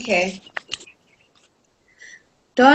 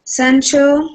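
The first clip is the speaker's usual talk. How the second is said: ordinary delivery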